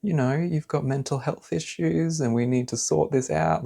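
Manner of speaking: sugary fake concern; fake caring partner. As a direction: imitate a manipulative sweet partner.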